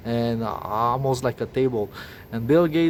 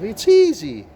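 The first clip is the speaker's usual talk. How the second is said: much higher and louder, exasperated; fed-up outburst; 8 semitones higher, 5 dB louder than usual. Real, not acted. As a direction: blurt it, having had enough. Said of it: "me swearing now, having enough".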